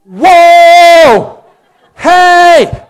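high, very loud alarmed shout; brain yelling. Retold or imitated. Brain yelling at the clerk scooping candy, alarmed shout. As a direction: yell to stop the thief. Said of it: "what does your brain yell".

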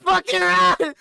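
urgent shout. Real, not acted. Fleeing monster in game.

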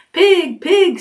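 high-pitched farmer's pig call; coaxing call. A narrator's reading. Farmer leading a pig to the yard, high coaxing call.

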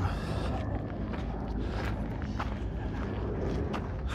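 long unvoiced panting exhale; heavy breath out. Real, not acted. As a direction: blow out hard after the climb. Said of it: "Pardon my breathing".

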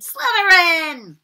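the hat screams the house name; screamed. Acted. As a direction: draw it out grandly. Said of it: "it screamed".

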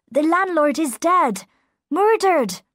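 loud, high alarmed cry. A narrator's reading. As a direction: shout the news of the murder. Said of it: "cried his servant".

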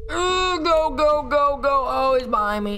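high frantic wail; high cry gradually sliding down; panicked fleeing. Real, not acted. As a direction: urge yourself on in terror. Fleeing the enemy.

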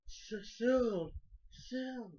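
high, drawn-out call of a name; mock tearful call. Brother seeing his lost sister, mock tearful call. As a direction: imitate a choked-up call, pitched high. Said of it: "he has the reaction that he's tearing up".

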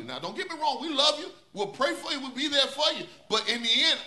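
ordinary talk, nothing marked

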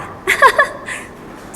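high, breathy demo giggle; giggle mid-speech. Shown as a demonstration. Teacher showing students the habit of laughing while talking, high giggle. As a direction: giggle as if you can't stop laughing. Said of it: "พูดไปหัวเราะไปค่ะ".

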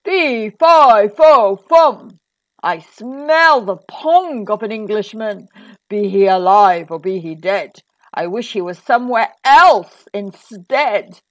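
loud booming giant's roar; big, loud storybook giant. A narrator's reading. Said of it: "roared the giant".

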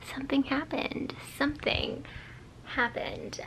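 soft unvoiced whisper so others sleep. Real, not acted. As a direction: hush it, people are asleep. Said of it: "I'm gonna be whispering because people are asleep".

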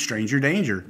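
usual speaking voice

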